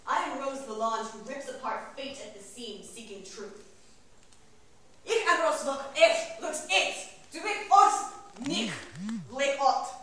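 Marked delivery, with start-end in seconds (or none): 5.0-9.9 high, loud, punched-out alien words; forceful invented-tongue declamation. Acted. Rose Lalonde proclaiming her name in a strange tongue, declaimed. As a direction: hurl out each strange phrase.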